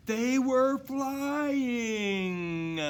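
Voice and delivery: high, slow, stretched-out wonder; thrilled exclamation. A narrator's reading. Narrator marvelling as Dasher takes off, high and drawn out. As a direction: let the wonder soar.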